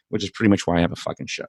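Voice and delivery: usual speaking voice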